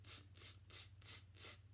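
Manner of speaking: quick voiceless "tss" clicks of mimicking monkeys; cheeky monkey tsking; monkey chatter tsks. A narrator's reading. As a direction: chitter "tss" softly, evenly, without voice. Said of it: "and said".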